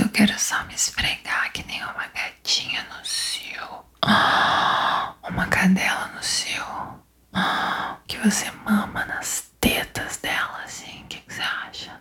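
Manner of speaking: hushed whisper to a lover; soft whisper. Acted. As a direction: let the voice drop to breath. Intimate whispered address.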